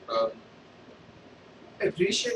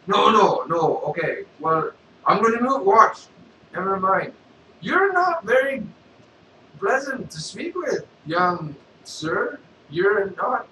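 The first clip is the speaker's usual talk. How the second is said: loud, high goofy Vader voice; a loud, silly Sith lord; Darth Vader; 6 semitones higher, 7 dB louder, slower than usual. Acted. Vader keeps talking to beggar.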